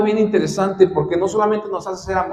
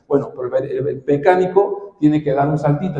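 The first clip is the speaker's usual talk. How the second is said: ordinary delivery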